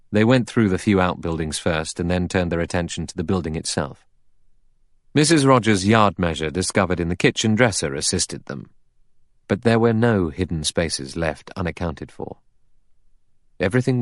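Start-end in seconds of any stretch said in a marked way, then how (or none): none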